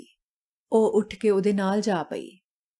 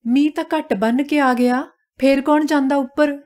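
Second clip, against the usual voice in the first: higher, louder comeback; defensive retort; 5 semitones higher, 5 dB louder than usual. A narrator's reading. Wife answering her husband's complaint, defensive and cross. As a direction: throw the blame straight back.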